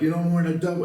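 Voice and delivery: high, stammering mock-weepy plea; imitating weepy Edward. Retelling Edward asking for his last meal, mock weepy. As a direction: whimper it like a doomed man near tears. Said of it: "he's stuttering and he's, you know, crying".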